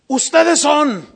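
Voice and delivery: loud, high, commanding stranger's voice; stranger's declaration. Retold or imitated. Stranger stops the missionaries in the street, loud declaration. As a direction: boom it out like a sudden accusation.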